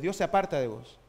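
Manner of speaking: normal talking voice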